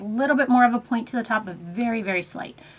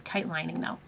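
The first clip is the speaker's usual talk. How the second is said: normal talking voice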